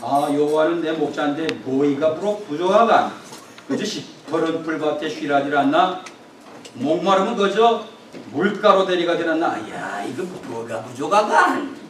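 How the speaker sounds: Psalm 23 recited in a hammed-up northern North Korean dialect; preacher reciting the psalm to the congregation, northern North Korean dialect, mock folksy; Pyongan dialect psalm. 북한 평안도 사투리로 낭송하듯. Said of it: "각 지역마다 사투리로도 시편 이십삼 편을 외웁니다".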